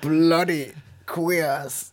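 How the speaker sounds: very slow, loud, high British sneer; British accent. Pretend. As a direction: sneer it like a drunk old Brit. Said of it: "britischen Akzent".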